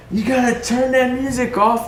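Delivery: high yell over ringing ears; dazed yelling. Retold or imitated. To the lieutenant after the blast, high dazed yell. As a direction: shout as if deafened by the blast. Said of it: "I'm just".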